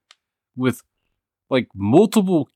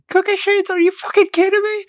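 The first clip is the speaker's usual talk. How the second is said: much higher shrieking outrage; mom's furious shriek. Retold or imitated. Son re-enacting his mom's cookie sheet scream. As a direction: shriek it in disbelief. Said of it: "that made my mom lose it the most".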